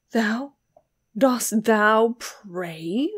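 loud, drawn-out mocking question, rising at the end; fiendish scorn. A narrator's reading. Giovanni mocking Beatrice as she prays, fiendish scorn. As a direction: stretch the question out in scornful disbelief. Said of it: "still with the same fiendish scorn".

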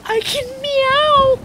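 delighted. Real, not acted. Delighted at the cat game.

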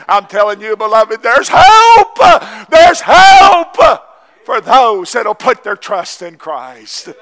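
a full-force preacher's cry, slow; preaching shout. Real, not acted. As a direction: shout the hope to the whole church. Preaching climax about hope.

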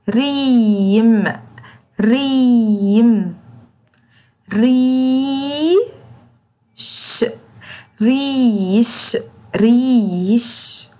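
very slow, ee held long in each word; slow teacher word drill; long drawn vowels. Shown as a demonstration. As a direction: drill the words slowly for children. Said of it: "وبطول في صوته".

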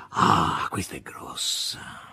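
hushed, slow, whispered aside. A narrator's reading. Volant, amused by the novel, whispered. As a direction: breathe it out amused.

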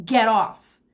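loud, mildly growled order; forceful, understated cat growl. A narrator's reading. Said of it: "I growled deep in my throat".

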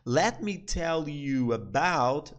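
very slow, each word separated; slow teaching demo. Shown as a demonstration. Teacher modelling the phrase for students, very slow. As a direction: drag it out so learners catch it. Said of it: "Deixa eu te falar sobre".

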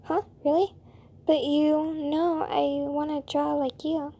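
usual speaking voice

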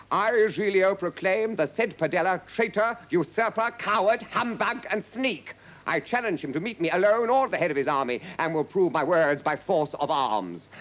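high, loud declamation; proclaiming herald. A narrator's reading. Herald reading Giglio's challenge to Padella, declaiming. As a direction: declaim each insult as a formal challenge.

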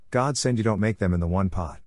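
ordinary delivery, nothing marked

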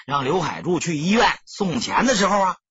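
usual speaking voice

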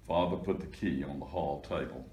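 usual speaking voice

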